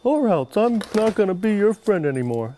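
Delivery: a lightly voiced wife, hammed-up; a playful mock threat. Pretend wife threatens husband to stay: mock childish threat.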